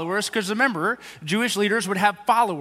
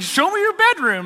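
silly literal ask; 10 semitones higher, 5 dB louder than usual. Retold or imitated. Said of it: "They're not just like".

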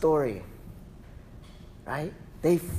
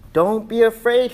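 usual speaking voice

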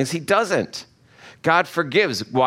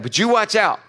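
higher-pitched, pointed warning; mock warning; 7 semitones higher than usual. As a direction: warn them off, half in jest. Mock warning to a repeat offender.

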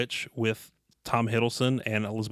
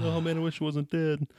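higher, lightly whiny ghost; 5 semitones higher than usual. Acted. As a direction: moan it like a sad ghost. Voicing dead character.

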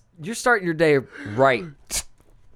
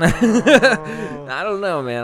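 laughing mock distrust; 3 semitones higher, 4 dB louder, slower than usual. Laughing, voicing distrustful old-timer.

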